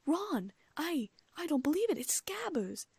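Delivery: high, breathless disbelief; excited shriek. A narrator's reading. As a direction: shriek it in shock. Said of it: "Then she let out a shriek".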